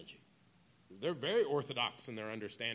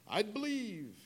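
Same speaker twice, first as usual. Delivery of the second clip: high, drawn-out parody of a team cheer; imitated rallying cry; mock cheer; 11 semitones higher, 3 dB louder, slower than usual. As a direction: throw in the cheer, pitched way up. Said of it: "and then they chant".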